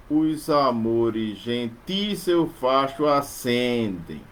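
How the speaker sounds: slow, syllable by syllable; slow metrical scansion; slow scansion reading. Shown as a demonstration. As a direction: read the verse slowly, marking beats. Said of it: "Novamente acentuado na sexta".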